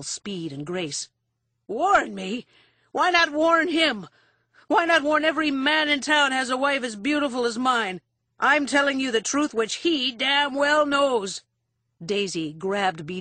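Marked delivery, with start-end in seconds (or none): 1.7-11.4 high, loud indignant protest; outraged drunken husband. A narrator's reading. Husband being hauled out of the bar, outraged. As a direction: protest loudly, pitched up in outrage.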